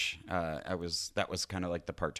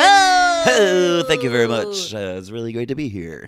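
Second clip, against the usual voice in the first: loud, bright radio DJ greeting; morning radio voice; 6 semitones higher, 14 dB louder than usual. Acted. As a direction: boom it out like a morning DJ. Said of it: "Is that your morning radio voice?".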